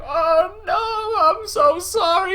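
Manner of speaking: very high, drawn-out fake wail; mock bawling. Villain apologizing to his dying dog, mock bawling. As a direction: wail it in hammed-up grief. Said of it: "starts bawling".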